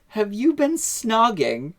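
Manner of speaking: high, slow imitation of a child; little girl voice. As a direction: ask like a nosy little girl. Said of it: "the little girl goes".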